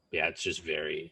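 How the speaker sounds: ordinary talk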